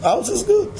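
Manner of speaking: high, light voice of contentment; a hint of a carefree believer; cheerful believer. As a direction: toss it off lightly.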